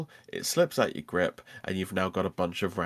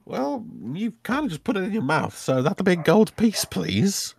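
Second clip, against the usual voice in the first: high, louder wizard voice asking payment; wizard shopkeeper; 10 semitones higher, 3 dB louder than usual. Acted. Wizard charging customer for the stone, insistent.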